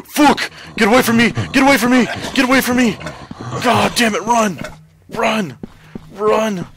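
shrieking high and loud in panic; terrified screaming. Real, not acted. Player fleeing the monster, terrified shrieking. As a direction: scream for your life as it lunges.

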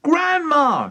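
high, loud shriek; high-pitched panicked call. A narrator's reading. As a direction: shriek it in alarm. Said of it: "shrieked Missus Cranky".

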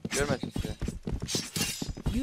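hushed, slow whisper; whispering while hiding. Real, not acted. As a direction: whisper so they can't see. Hiding from enemies.

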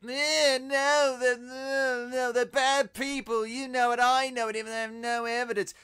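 exaggerated childish protesting voice; mocking Eddie Brock. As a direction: imitate a petulant objector, high and loud. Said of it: "And he's like".

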